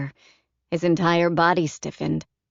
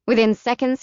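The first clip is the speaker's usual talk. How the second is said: ordinary delivery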